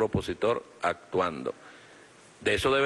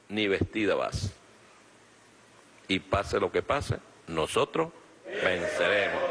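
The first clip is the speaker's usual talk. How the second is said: slow, low, deliberate defiance; slow defiant vow; 4 semitones lower, slower than usual. Real, not acted. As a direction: let each word land heavily.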